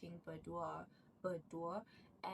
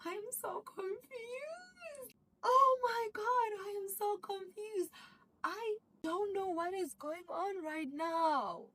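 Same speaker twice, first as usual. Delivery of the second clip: much higher, whiny near-cry, then loud; overwhelmed confusion; 12 semitones higher, 8 dB louder, slower than usual. Retold or imitated. Viewer lost in the drama's twist, high whiny wail. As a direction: wail it like the plot broke you.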